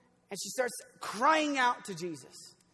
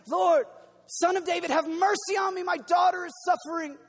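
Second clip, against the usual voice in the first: loud, higher desperate plea; retold cry for mercy; 7 semitones higher, 7 dB louder than usual. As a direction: cry out Lord, son of David with a mother's desperation. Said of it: "crying out saying".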